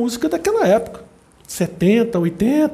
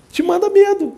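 high emphatic outburst; 12 semitones higher, 4 dB louder than usual. Real, not acted.

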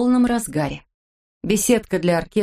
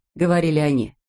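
plain reading voice

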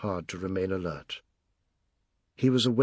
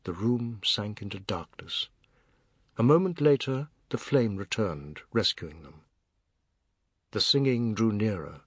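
usual speaking voice

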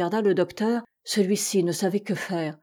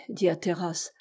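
ordinary delivery, nothing marked

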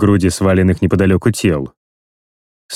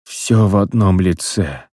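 strained. Acted. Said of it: "выдавил умирающий".

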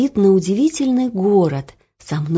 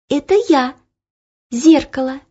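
high chiming magic mirror; ringing crystal chime voice; 7 semitones higher than usual. Acted. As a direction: ring out like glass. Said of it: "снова зазвенел голос".

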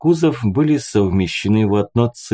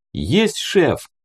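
mild mock bark; 7 semitones higher than usual. A narrator's reading. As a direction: snap out an eager acknowledgement, pitched up. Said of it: "рявкнул тот". (бодрый рявк, без крика)

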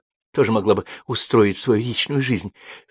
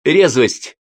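loud, high, sharp correction; emphatic one-word correction; correcting; 7 semitones higher, 7 dB louder than usual. A narrator's reading. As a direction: jump in to fix the wrong word. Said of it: "поправил Толумбаш второй".

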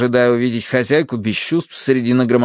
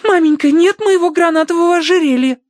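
very high, loud lament; girlish exclamation; 5 dB louder than usual. A narrator's reading. Daughter runs downstairs to report losses, exclaiming. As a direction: squeal the loss to mother. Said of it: "воскликнула она".

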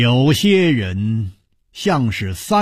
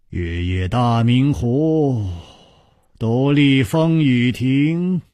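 composed monk; 5 semitones lower, slower than usual. Acted. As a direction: recite and greet unhurriedly, like a serene monk. Narrator voices the monk Wuhua. (从容低缓的僧人口吻)

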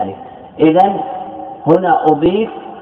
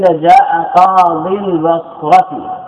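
جاء قاضي البصرة said slowly, word by word; slow model sentence; 2 semitones higher, much slower than usual. Shown as a demonstration. Teacher slowly reading an example sentence to the class. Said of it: "لو قيل".

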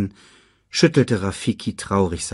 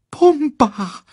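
ordinary delivery, nothing marked

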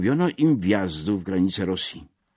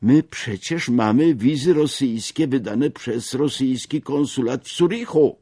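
a higher, louder feverish cry of protest; feverish exclamation; 8 semitones higher, 6 dB louder than usual. A narrator's reading. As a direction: blurt it out in alarm, voice pitched up. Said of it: "gorączkowo wykrzyknął jeden z nich".

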